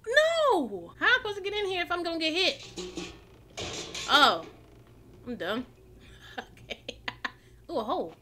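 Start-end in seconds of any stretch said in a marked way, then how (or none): none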